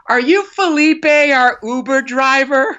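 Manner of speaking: put-on high, slow, slurred drunk woman. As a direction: play the hammered stranger at the car window. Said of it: "She just goes".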